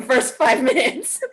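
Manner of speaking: laughing through the words, pitch jumping up. Real, not acted. Narrator laughing to the Zoom audience about the glitch. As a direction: laugh it off, amused at the tech failure. Joke about the broken first minutes.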